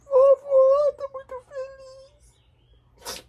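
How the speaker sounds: wailing high and loud through hammed-up tears; fake sobbing. Grandson sobbing over a mug from grandma, hammed-up wail. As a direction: overdo the crying to hide disappointment. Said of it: "Você tá chorando".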